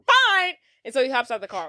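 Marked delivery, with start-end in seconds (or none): 0.1-0.5 high, loud huffy retort; mock exasperated outburst. Host retelling Marty calling the driver's bluff, loud mock exasperation. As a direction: burst out in play-acted exasperation. Acted quarrel, giving in angrily.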